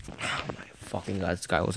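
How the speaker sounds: sighing exasperated whisper; fed-up whisper under the breath. Real, not acted. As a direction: whisper it through a sigh. Annoyed aside after sigh.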